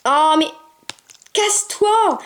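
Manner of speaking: fed-up outburst; real exasperation at a stubborn puppy; exasperated. Real, not acted. Exasperated at the dog, apologises.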